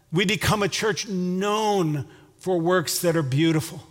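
ordinary talk

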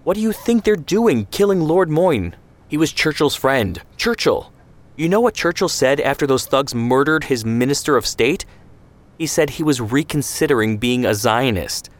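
high, pushed indignant tirade; heated rant. Acted. Ben Shemen berates Zvi about the Etzel, heated. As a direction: hammer him with each point.

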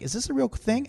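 high-pitched mock disbelief; incredulous question. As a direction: ask it as if you can't believe it. Quoting own disbelief at serious answers.

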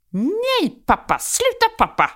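put-on high, whiny little girl pushing dad off; toddler protest. Mother voicing her daughter telling dad to stop, in a high whiny voice.

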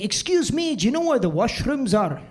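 imitating a thick Scottish accent; lilting, swooping Scottish brogue. Customer asks waiter for the washrooms, thick Scottish accent. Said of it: "a really thick Scottish accent".

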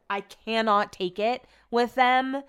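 usual speaking voice

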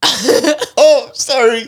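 high, loud mock apology; mock embarrassed. Child walking in on mom, high mock apology. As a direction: squeak it out high, caught off guard. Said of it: "Like, just seeing".

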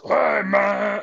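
put-on high, slurring drunk greeting; acting drunk. Drunk friend answers a call on speakerphone, hammed-up drunk. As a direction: play up a drunk man's hello. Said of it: "Academy Award for acting, like, drunk".

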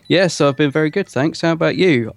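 ordinary delivery

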